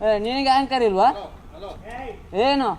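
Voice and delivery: real angry shouting match; furious shouting. Real, not acted. Rider throws insults back in a quarrel, furious shouting. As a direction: hurl it back at full volume.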